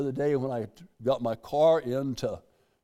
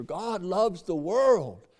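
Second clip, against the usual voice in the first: slow emphasis; 4 semitones higher, much slower than usual. Real, not acted. Preacher's emphatic statement.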